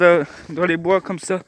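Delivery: ordinary delivery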